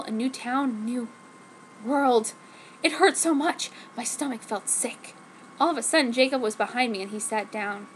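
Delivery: ordinary delivery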